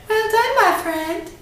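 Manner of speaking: very high, prissy villain voice; a fussy, snooty villain laying down the terms. Acted. Unknown ranger giving Jason a cruel choice, high prissy voice. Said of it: "the prissy voice".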